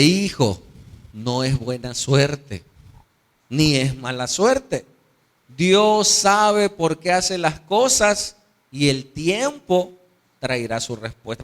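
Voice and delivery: slow, measured counsel; deliberate fatherly correction, phrase by phrase; wise old father. Acted. Father answering his excited son about luck, slow and measured. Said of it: "El papá le dice".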